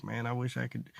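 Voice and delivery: dad's soft, wistful sigh of a line; lightly voiced dad, low and soft; quiet wistful. Dad wishing he could still drink beer, hushed and wistful.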